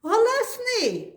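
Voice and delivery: high squeaky little mouse; a teacher's high mouse puppet squeak. Acted. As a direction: chirp it like a tiny mouse.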